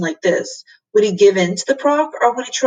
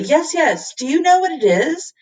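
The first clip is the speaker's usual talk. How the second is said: higher, eager calling out; excited cry; 8 semitones higher than usual. A narrator's reading. Tom to the professor, excited cry. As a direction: blurt it out with rising excitement. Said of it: "he cried".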